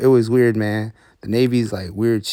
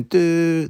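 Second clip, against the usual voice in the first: horn beep; 7 semitones higher than usual. Retold or imitated. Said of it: "beeping his horn".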